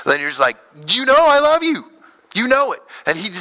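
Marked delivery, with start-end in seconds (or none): 0.9-2.8 high, loud, frustrated cry; exasperated outburst. Retold or imitated. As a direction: blurt it out like a hurt friend who's had enough. Disciple snaps at Jesus, fired up.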